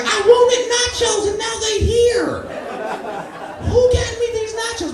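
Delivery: shrieking with delight at the snack stand; childish glee. Retold or imitated. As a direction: squeal like a kid over nachos. High-voiced childish excitement at nachos.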